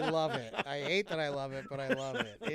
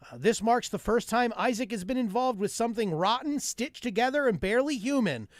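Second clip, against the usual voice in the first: raised, punchy mock newscast; joke news read; 4 semitones higher, 4 dB louder than usual. Reading a Whole Foods union headline, punchy mock news read. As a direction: deliver the headline, then drive to the punchline.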